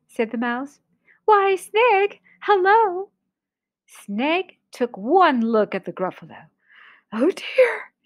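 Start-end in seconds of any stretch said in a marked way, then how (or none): 1.2-3.1 high, sing-out cheery greeting; pitched-up mouse hello. Acted. 7.1-7.9 whispered storybook dismay; hushed snake voice; scared snake. Acted. Snake is frightened.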